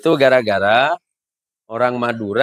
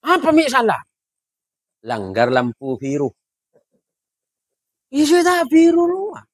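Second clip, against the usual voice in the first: put-on high Madurese accent, indignant; old Madurese man; 10 semitones higher than usual. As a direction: protest in high village Madurese. (logat Madura tinggi, protes)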